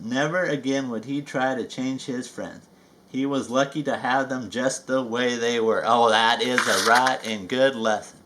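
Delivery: usual speaking voice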